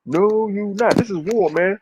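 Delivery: emphatic refusal. Acted. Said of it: "I'm stopping everything".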